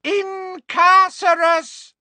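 high, loud, drawn-out incantation; shouted spell. Acted. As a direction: cry out the spell at full voice. Spell cast at charging centaurs.

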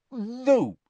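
dignified, through a full mouth. Acted. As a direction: answer with cheeks full of food. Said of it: "her mouth bulging with sprouts".

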